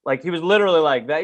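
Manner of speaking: normal talking voice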